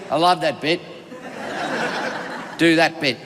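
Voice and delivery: low voice of a demanding host; gruff billionaire. Retold or imitated. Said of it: "And he goes".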